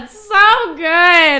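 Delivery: high, loud, slow, almost crying; swooning. Real, not acted. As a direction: melt over the words, loud and slow. Swooning over the book.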